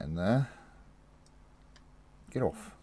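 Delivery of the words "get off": grunting at a stuck lid; effortful, straining; opening a stuck jar. Real, not acted. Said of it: "Oh, I can't get the lid off".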